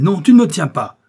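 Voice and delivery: very loud, high outcry; defiant shout. A narrator's reading. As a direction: yell it at your captor. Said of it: "cria-t-il".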